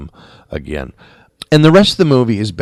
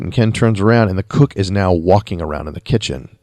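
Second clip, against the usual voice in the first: ordinary talk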